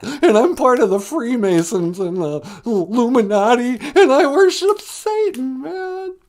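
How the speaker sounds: high, loud pleading; terrified lion caller. Acted. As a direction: beg for help in a panic. Lion confesses fear.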